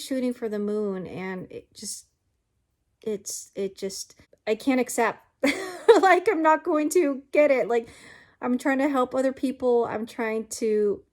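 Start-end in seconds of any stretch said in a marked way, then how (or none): 5.4-6.0 short, high, rueful laugh. Real, not acted. At her own stubbornness, a short rueful laugh. Laugh after admitting she can't accept it.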